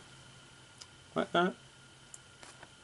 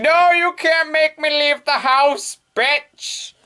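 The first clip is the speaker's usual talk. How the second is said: put-on high, whiny shut-in girl voice; slower than usual. Hatsuyuki refusing to leave the house, whiny high protest. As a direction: whine like a stubborn shut-in. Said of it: "Hatsuyuki be like".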